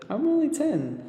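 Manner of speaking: put-on high, quick little girl; young girl boasting about her age; girl character voice. A narrator's reading. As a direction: say it proudly like a child.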